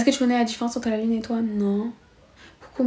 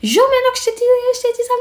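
high strained mock shout, much higher; retold shouting; 14 semitones higher, much faster than usual. Girl replaying her shouting at the boy, strained high yell. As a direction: yell it like the video of the fight. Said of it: "on me voit en train de crier".

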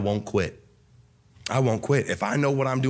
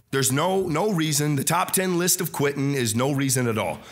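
ordinary talk

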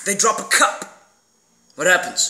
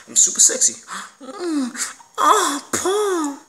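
mock swooning; 7 semitones higher, slower than usual. Posing, then a mock swoon at himself.

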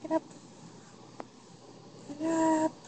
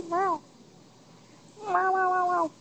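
higher, laughing cat meows; playful meows; 4 semitones higher than usual. Pretend. Owner meowing back at her cat, laughing. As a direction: meow at the cat through a laugh.

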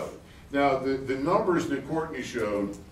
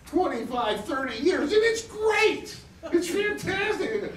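much higher and slow, gushing; excited; 14 semitones higher, slower than usual. Real, not acted. Describing off-grid life, excited. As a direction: savour it, thrilled. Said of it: "I get pretty excited".

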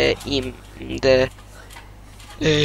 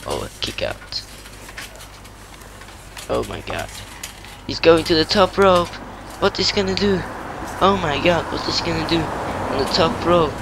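wrestling commentator. Pretend. Kid calls the match live.

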